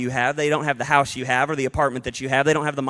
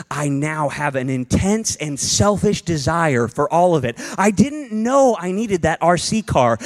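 ordinary talk, nothing marked

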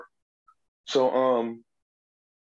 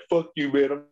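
higher, pushy mock drunk snarl; belligerent drunk; 5 semitones higher than usual. Drunk guy on the stairs telling him off, mock belligerent. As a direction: play the hostile drunk lightly. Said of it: "he's immediately belligerent".

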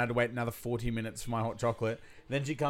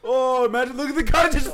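high, loud excited cry; 11 semitones higher, 7 dB louder than usual. Real, not acted. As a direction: point it out, thrilled and loud. Watching the coach react.

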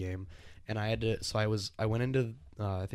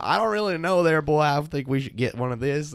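put-on cowboy accent; 6 semitones higher, 9 dB louder than usual. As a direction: play the cowboy player, loud and high. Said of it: "like, the cowboy accent".

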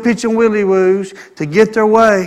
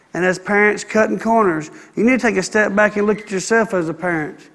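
normal talking voice

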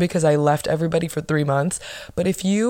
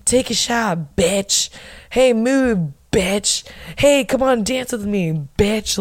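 higher-pitched, over-the-top party bully; aggressive, over the top; 4 semitones higher, slower than usual. Retold or imitated. Cast member goading friends to drink and dance, aggressive and over the top. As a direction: imitate her aggressively, hammering each "bitch". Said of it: "It's a very aggressive, over the top".

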